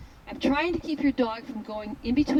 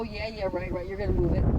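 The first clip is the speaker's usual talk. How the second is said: sarcastic dog; 4 semitones lower than usual. Retold or imitated. Dog ignoring the trainer's stick, mock-sarcastic. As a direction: brush off the warning with a dismissive sneer.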